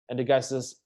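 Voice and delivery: ordinary talk, nothing marked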